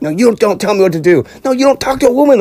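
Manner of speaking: mock bickering, voices rising; John and Phil shoving each other, retold heat; heated argument. Retold shoving match in court.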